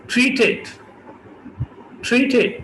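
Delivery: ट्रीटेड with puffs on both t; aspirated t. Shown as a demonstration. Teacher models ट्रीटेड aspirated. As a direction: say ट्रीटेड as thrithed. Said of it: "दोनों aspirate होंगे".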